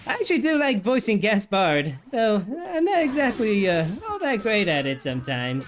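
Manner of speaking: put-on high, fast excitable boy voice; Gaspard voice. Shown as a demonstration. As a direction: pitch it high and eager. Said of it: "That's very good Gaspard voice acting".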